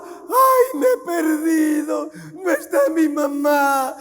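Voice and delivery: put-on high, loud whiny child wailing for mama; hammed-up sobbing kid begging for help; mock crying child. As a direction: play a lost little boy crying out loud.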